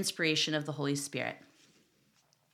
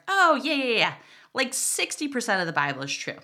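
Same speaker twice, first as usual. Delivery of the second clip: breezy dismissive; 6 semitones higher, 4 dB louder than usual. Pretend.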